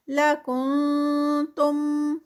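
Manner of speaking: لکنتم very slow, with the ن hidden into ک; Quran recitation. Shown as a demonstration. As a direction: recite لکنتم with ikhfa on the نون. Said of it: "اخفا ہے".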